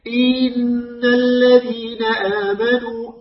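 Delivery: "إن الذين آمنوا" بمطّ كسرة الهمزة "إين"; رتّل ببطء وأشبع همزة "إن" حتى تصير ياءً; كسرة ممدودة ياءً. Shown as a demonstration. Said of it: "يمطها فيتصبح ياء". (تلاوة "إن الذين آمنوا" بياء زائدة بعد الهمزة)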